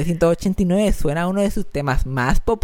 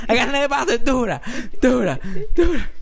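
high, mock performer's refrain; imitating the singer; 5 semitones higher than usual. Podcaster mimicking a wired singer about to burst, high mock refrain. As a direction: mimic him high, each "dura" falling. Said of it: "anécdota a dos días de que el corazón le explotara".

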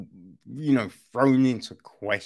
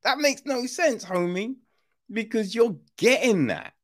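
pitched way up in disbelief; can't believe the excuse, voice climbing high; high, baffled "that makes no sense, homie"; incredulous; 8 semitones higher than usual. Retold or imitated. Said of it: "the explanation is just like".

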